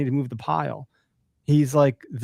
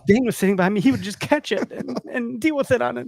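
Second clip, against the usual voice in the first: imitating daughter; 9 semitones higher than usual. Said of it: "She's like".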